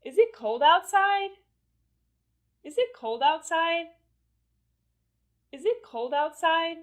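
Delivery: high question held up on "outside"; rising intonation. Shown as a demonstration. Teacher giving another example to learners, rising intonation on "outside". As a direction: keep the pitch up on "outside". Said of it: "notice the rising intonation".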